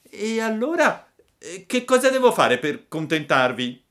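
high-pitched, questioning puppet; Pinocchio's puppet voice. Acted. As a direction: ask back in a small high voice. Pinocchio questions the hostile boys. (vocina acuta di burattino)

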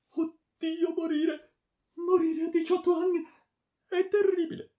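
very high, loud wail; terrified lament. A narrator's reading. Daughter facing death at eighteen, terrified wailing. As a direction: wail in horror of dying.

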